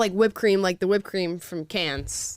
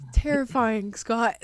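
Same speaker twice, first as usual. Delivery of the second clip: ordinary talk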